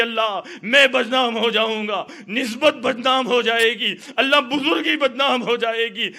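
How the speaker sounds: loud, high, sobbing plea to God; weeping prayer. Acted. The saint begs God in tears, loud and high. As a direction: cry out to God, begging. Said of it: "رو رو کے کہنے لگے".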